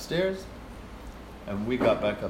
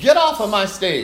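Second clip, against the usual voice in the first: higher, louder angry bark at an intruder; angry conductor yelling; 8 semitones higher, 7 dB louder than usual. Retold or imitated. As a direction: snap at her like an outraged maestro.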